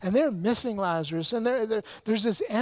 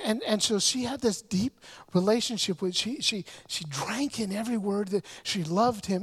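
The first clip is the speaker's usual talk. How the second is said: usual speaking voice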